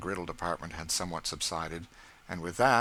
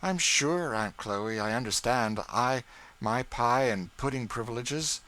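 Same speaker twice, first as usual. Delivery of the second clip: put-on boy's voice; 6 semitones higher, 5 dB louder than usual. A narrator's reading. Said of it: "said George".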